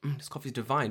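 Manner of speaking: whispered, savoring the coffee. Retold or imitated. Whispering to herself over coffee, savoring it. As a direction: hush it like a secret treat. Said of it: "and I'm like".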